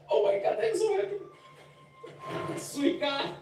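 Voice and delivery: high, slow squeal of joy; giddy delight at a beloved character; overjoyed. Real, not acted. Overjoyed reaction to favourite character.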